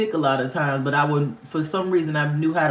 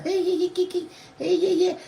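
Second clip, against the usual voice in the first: high mocking hey hey cackle; mock cackling hosts; 13 semitones higher than usual. Imitating the hosts cackling at the ex's story, high and mocking. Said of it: "they cackling and laughing".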